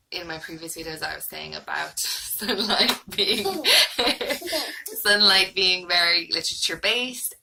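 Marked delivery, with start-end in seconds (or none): 2.0-7.3 laughing. Real, not acted. Child being silly on camera.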